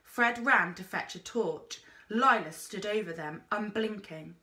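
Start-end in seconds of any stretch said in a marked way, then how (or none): none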